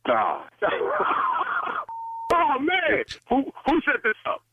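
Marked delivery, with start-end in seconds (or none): 0.5-2.3 breathless high-pitched laugh; high wheezing laugh. Real, not acted. Prank revealed, dispatcher laughs.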